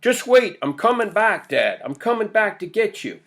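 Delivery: put-on high, loud boyish calling; urgent yell. A narrator's reading. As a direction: call out reassurance to a trapped father. Boy keeps screaming to trapped father.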